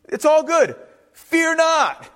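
high-pitched, emphatic reassurance; Moses rallying people. Acted. Moses reassuring people chased by armies, emphatically. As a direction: call it out over a frightened crowd. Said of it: "Moses is like".

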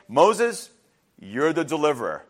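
measured, low pronouncement; a hint of a solemn divine commission; lightly voiced God. God appointing Moses from the burning bush, lightly solemn.

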